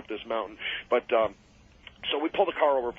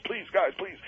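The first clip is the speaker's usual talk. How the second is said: tearful pleading; 7 semitones higher than usual. Retold or imitated. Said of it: "he's almost in tears".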